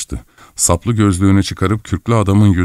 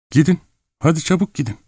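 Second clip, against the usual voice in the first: high, hurried plea to leave; urgent shooing; 10 semitones higher, faster than usual. A narrator's reading. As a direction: rush them off in a high voice. Woman urgently sending men away. (telaşlı, tiz sesle kovalama)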